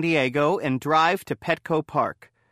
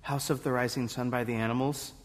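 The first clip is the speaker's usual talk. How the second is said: soft, quick, low mumbled answer; timid, hushed reply to the judges; too quiet to hear; 3 semitones lower, 8 dB quieter, faster than usual. Acted. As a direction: say it small and fast, barely audible.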